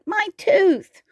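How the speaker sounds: high wail, falling at the end; acted high-pitched cry; crying out in pain. A narrator's reading. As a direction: wail over a sore tooth. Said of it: "he cried".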